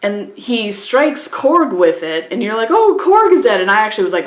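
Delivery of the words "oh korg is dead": mock shocked gasp. Viewer reacts to Korg's death.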